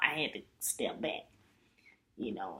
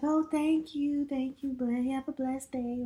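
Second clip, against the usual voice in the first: put-on high grateful young woman; 10 semitones higher, 4 dB louder than usual. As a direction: thank her sweetly in a lifted voice. Lady thanking her, retold.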